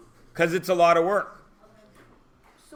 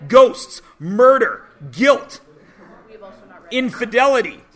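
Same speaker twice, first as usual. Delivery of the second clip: excited list; 5 semitones higher, 7 dB louder than usual. Real, not acted. Teacher hyping Macbeth's thrills.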